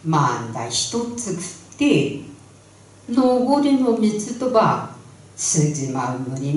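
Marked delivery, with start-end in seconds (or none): none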